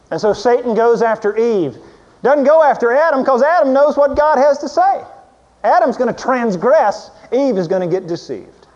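loud, high, driving sermon voice; preaching at full force. Acted. Preacher building up about Satan going after Eve, loud and high. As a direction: build the point and hammer it home.

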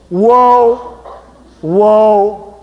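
a big loud call to stop, drawn out; loud whoa. Shown as a demonstration. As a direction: call it out like reining in a horse. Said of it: "It's spelled W H O A, exclamation".

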